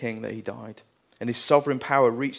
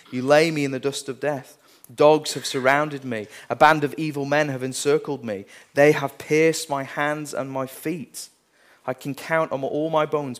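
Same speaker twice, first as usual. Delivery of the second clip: louder, preaching the psalm at full force; forceful psalm reading; 5 dB louder than usual. Acted.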